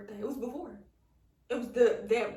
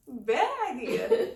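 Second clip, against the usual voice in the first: laughing; 2 semitones higher, 8 dB louder, much slower than usual. Real, not acted. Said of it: "I called my mom".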